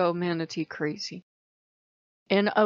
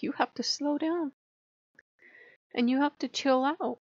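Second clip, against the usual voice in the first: gentle manatee; 7 semitones higher than usual. Retold or imitated. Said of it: "Manatee's usually too gentle a totem".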